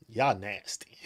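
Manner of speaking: disgusted whisper. Acted. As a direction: whisper it with a sneer. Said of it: "The squirrels look at y'all like".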